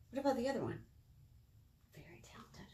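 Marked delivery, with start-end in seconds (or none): 1.9-2.7 very soft whisper; whispered praise. Real, not acted. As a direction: breathe the compliment quietly. Soft praise of balancing children.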